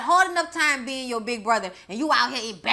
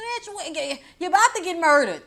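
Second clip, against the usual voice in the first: yelling at the character; 6 semitones higher, 3 dB louder than usual. Acted. Yelling at the screen.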